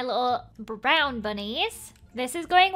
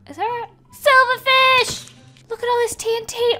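high, loud startled exclaim; shocked, rushing words; alarmed excitement; 10 semitones higher, 8 dB louder than usual. Real, not acted. Discovers trap full of TNT.

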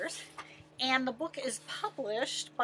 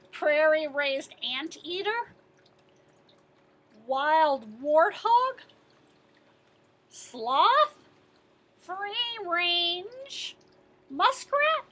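louder, rising disbelief on each dish; menu items with mounting disgust; 4 semitones higher, 5 dB louder, slower than usual. Acted. Teacher reading the lion's menu, growing louder. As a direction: read each item more shocked.

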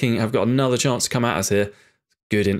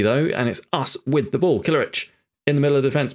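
normal talking voice

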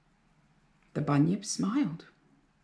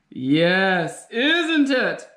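loud gleeful bunyip cry; happy holler; 3 dB louder, much slower than usual. A narrator's reading. Bunyip loving the scary noise, happy holler. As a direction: crow with delight. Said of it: "hollered the bunyip happily".

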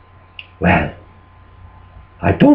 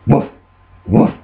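two short imitated woofs; dog barks; 5 semitones lower than usual. Farm dog butting in, imitated barking. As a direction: yap twice like a dog.

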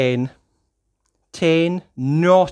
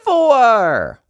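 high, louder big finish; excited reveal; 11 semitones higher, 8 dB louder than usual. Acted. Reader revealing the pigs to a child, excited. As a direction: land the last number with delight.

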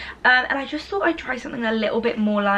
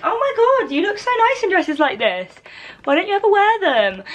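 imitating gushing admirer; 9 semitones higher than usual. Vlogger quoting Kobe on the dresses, high-pitched imitated gushing. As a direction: imitate someone cooing a compliment, pitched way up. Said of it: "and he's like".

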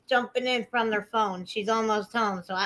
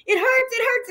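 very high imitation of someone hurting; mock pained whine; 14 semitones higher, 3 dB louder than usual. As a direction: imitate a brother yelping in pain. Retold brother complaining of pain.

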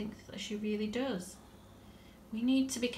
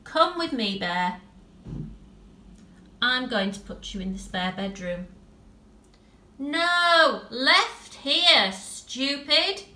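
put-on higher and louder bossy girl; impatient little girl; 5 semitones higher, 8 dB louder, slower than usual. Tilly leads the bear upstairs, impatient bossing. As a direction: steer him along, snapping stupid.

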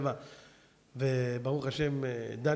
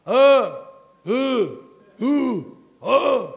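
loud, higher mocking heckler bleats; heckler yelling; 7 semitones higher, 13 dB louder than usual. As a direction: shout interruptions mid-sermon. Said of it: "צועק באמצע".

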